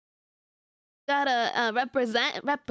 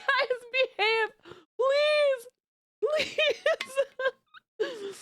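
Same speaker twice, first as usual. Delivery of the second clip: laughing mock scold; 14 semitones higher, much slower than usual. Mock scolding chat while laughing.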